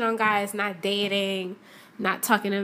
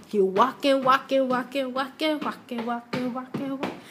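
trudging repetition. Shown as a demonstration. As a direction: let the walking trail off into the distance. Acting out a long walk.

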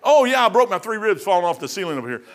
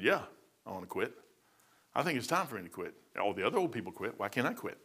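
much softer, weary; tired giving up; 5 semitones lower, 9 dB quieter than usual. Retold or imitated. Preacher telling God he wants to quit, soft and weary. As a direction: sigh it out like you're done. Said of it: "I said".

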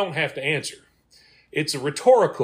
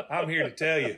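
normal talking voice

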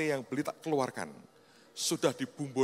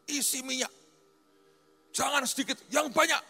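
rising higher and louder to a near shout; building command; 14 semitones higher, 6 dB louder than usual. Retold or imitated. Host pastor ordering lots of oil, building to a shout. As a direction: demand more and more oil.

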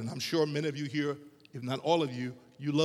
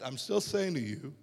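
ordinary talk

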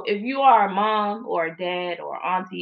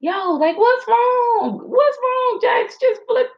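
put-on very high, half-crying plea to a child; desperate pleading; slower than usual. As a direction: beg your splashing toddler in a strained squeak. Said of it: "And I'm just like".

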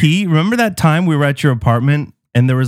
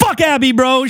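high, playful diss; jokingly trash Abby; mock diss; 8 semitones higher than usual. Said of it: "Shots fired".

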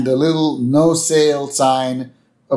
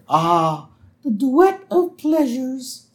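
high, slow, savouring voice; put-on high cartoon voice; blissful nerdy pupil; 12 semitones higher, slower than usual. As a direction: sigh it out in precious delight. Eager pupil savouring quiet time.

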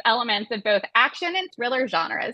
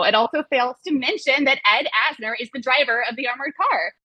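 ordinary talk, nothing marked

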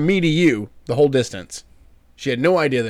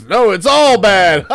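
put-on slow, higher and louder grumpy old man; old Muppet heckler; 7 semitones higher, 8 dB louder, slower than usual. Al answers the host at the game, as an old heckler. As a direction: snap back like the balcony heckler. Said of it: "two old guys from the Muppets".

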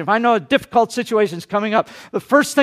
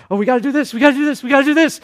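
hurried, anxious babbling, faster each time; mock panic; 3 semitones higher, much faster than usual. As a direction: rattle it off like a flustered worrier. Panicked self running around.

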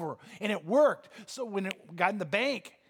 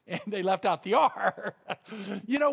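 punchline said through laughter; laughing. Real, not acted. Preacher repeats his joke's punchline to the congregation, laughing. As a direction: chuckle your way through the line.

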